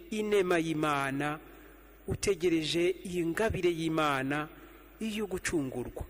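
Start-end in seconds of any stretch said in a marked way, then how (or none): none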